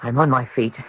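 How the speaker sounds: ordinary talk, nothing marked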